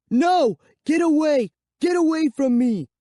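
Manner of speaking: high, loud panicked howl. Acted. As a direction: yell to push the vampire away. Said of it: "I howled".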